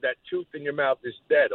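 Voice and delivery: ordinary talk, nothing marked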